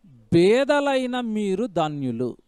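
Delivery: each word of 'బేదలైన మీరు ధన్యులు' drawn out very slowly; slow verse reading. Shown as a demonstration. Preacher to congregation, reading the Beatitude very slowly. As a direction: read the verse word by word so it sinks in. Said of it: "బాగా వినండి ఇవన్నీ".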